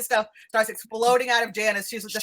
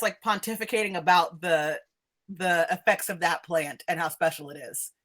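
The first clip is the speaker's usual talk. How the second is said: usual speaking voice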